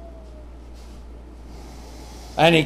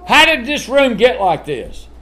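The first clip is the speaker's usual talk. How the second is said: raised scolding parent voice; parent questioning a child; 7 semitones higher, 5 dB louder than usual. Retold or imitated. Parent asking child about messy room, mildly stern. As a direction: demand an answer from a messy kid. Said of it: "what you always ask kids".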